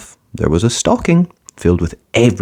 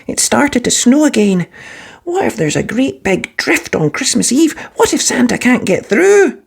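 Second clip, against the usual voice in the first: put-on higher, small boy's anxious voice; young boy Arthur, worried. A narrator's reading. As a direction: sound like a worried little kid. Arthur worries about snow.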